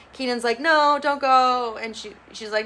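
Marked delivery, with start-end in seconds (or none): 0.6-1.8 high, slow, pleading no; imitating a desperate plea; pleading cry. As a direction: beg her not to leave, dragging it out. Keenan begs K K to stay.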